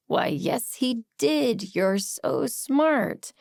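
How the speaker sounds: slow, dry sarcastic praise. Acted. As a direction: drag out the mock compliment. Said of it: "There is a time and a place for sarcasm".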